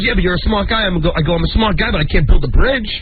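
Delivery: usual speaking voice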